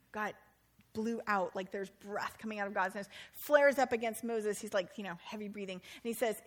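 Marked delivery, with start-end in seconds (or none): none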